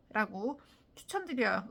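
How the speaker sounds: ordinary talk, nothing marked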